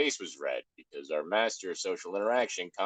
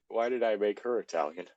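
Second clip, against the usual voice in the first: ordinary delivery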